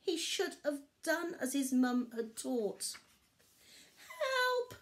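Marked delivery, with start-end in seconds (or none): 4.0-4.7 high calling cry from a pit; cry for help. A narrator's reading. Barley trapped in a pit, calling for help. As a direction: call up for help. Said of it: "he cried".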